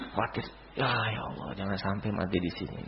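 soft anxious aside of a sick man; worried inner voice. Retold or imitated. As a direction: voice Sa'ad's fear to himself, quietly. Sa'ad's worried thoughts voiced.

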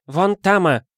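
put-on high, broken-Russian boy's voice; a young street boy chattering up high; Chinese boy. Chinese boy pointing again.

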